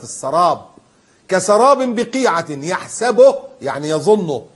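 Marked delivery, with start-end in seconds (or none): 1.3-3.4 drawn out, higher and louder chanting of the verse; slow Quran recitation. Real, not acted. Preacher reciting to his congregation, slow and chanted. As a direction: stretch each word like a recited verse.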